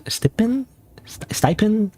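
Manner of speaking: whispered, hesitant try at stipend; unsure whisper. Real, not acted. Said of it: "I actually don't know how to pronounce that".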